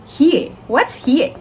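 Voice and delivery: high, loud mock disbelief; incredulous question. Examiner to student, high, loud incredulous disbelief. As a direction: ask like you can't believe the answer. Examiner mocking her answer.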